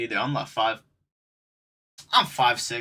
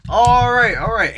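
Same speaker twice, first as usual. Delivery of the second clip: much higher, louder clipped all right; mock exasperated manager, higher and louder; manager hanging up; 9 semitones higher, 9 dB louder than usual. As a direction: shout it like an exasperated boss. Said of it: "And he was like".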